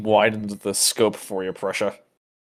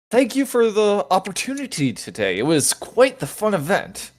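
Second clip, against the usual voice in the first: raised, lightly put-on voice; in-character voice; 7 semitones higher than usual. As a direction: say it up high as your character. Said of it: "I say".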